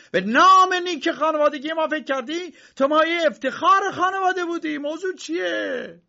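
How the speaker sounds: very high, loud, outraged scolding. Acted. Uncle yelling at Josef over the criminal case, very loud and high. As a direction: rage about the family name. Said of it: "عمو بلندتر داد زد".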